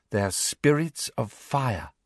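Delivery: slow, lightly voiced dreamy girl; solemn Luna. Girl explaining mythical creatures, slow and solemn. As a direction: explain it slowly, full of belief.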